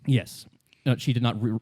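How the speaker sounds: ordinary delivery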